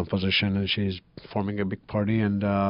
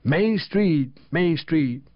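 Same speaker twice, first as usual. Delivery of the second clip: Georgian accent, high, slow chanted poem; 12 semitones higher, 6 dB louder, slower than usual. Shown as a demonstration. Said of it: "I should read it with my Georgian, with my Georgian, uh, accent".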